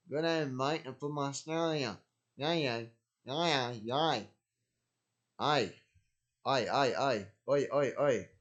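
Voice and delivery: hammed-up Aussie voice, lightly done; bad Australian accent. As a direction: put on a cheesy Australian twang. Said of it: "that's a really bad Australian open".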